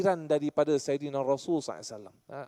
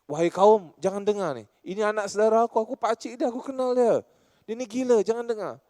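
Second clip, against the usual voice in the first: mock crowd warning; 6 semitones higher, 3 dB louder than usual. Abu Lahab shouting warning to crowd.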